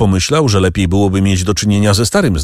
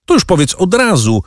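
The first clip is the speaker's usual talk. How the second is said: bitter shout; 10 semitones higher than usual. A narrator's reading. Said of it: "krzyknął Danglars z nutą goryczy".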